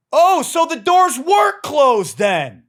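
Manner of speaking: top-of-the-lungs sarcastic scream; furious shout. Retold or imitated. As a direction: bellow it in disbelief at the gate agent. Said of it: "at the top of my lungs".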